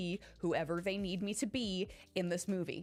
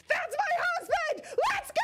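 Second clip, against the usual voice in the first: screamed way up high and loud with a laugh, slowly; crazed fangirl shriek; 6 dB louder than usual. Pretend. Imagining mocap for the movie, screaming as a crazed fangirl. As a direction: lose it completely like a fangirl spotting her man. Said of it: "the craziest of fangirl energy".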